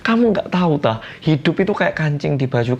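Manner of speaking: high, frantic mock yell of a desperate mother. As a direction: shriek it out, begging her to stop. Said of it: "Mamanya di situ udah teriak-teriak". (teriakan ibu yang panik, nada tinggi)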